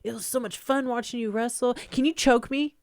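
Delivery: breathless fangirl gush, rising high at the end; fan gushing. Retold or imitated. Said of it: "and she's like".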